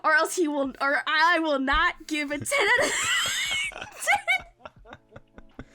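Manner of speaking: loud, laughing demand, words drawn out; shouting and laughing while making a joking demand. Real, not acted. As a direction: laugh through a mock ultimatum.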